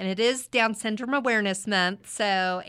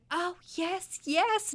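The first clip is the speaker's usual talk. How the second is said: put-on excited child; 11 semitones higher, slower than usual. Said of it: "And Daniel said".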